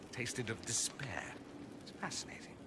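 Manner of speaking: dry whispered aside. Acted. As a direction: let the joke drop to almost nothing. Dry joke trailing off.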